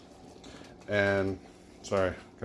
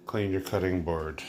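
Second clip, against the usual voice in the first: ordinary delivery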